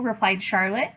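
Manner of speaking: plain reading voice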